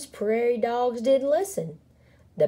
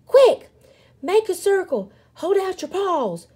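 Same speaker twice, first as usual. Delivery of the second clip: high, urgent commands; yelling; 12 semitones higher, 6 dB louder than usual. A narrator's reading. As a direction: call out orders in a hurry. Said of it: "yelled Pip".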